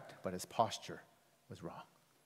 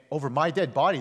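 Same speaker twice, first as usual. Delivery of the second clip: louder, mildly emphatic "Over my dead body"; emphatic refusal; 2 semitones higher, 6 dB louder than usual. Retold or imitated. Peter refusing to let Jesus suffer, emphatic "Over my dead body". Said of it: "makes it very emphatic".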